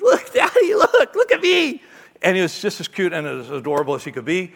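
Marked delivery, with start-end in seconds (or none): 0.0-1.8 put-on high, giggling little boy showing off; excited toddler. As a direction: squeal it like a two-year-old in huge mittens. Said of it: "He, he goes".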